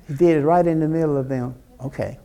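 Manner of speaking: usual speaking voice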